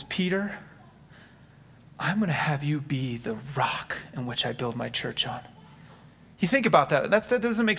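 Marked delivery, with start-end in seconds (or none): 0.0-5.4 soft whispered words of Jesus to Peter; quiet breathy whisper of grace; hushed Jesus. Acted. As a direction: whisper it like a tender promise. Jesus speaking tenderly to Peter.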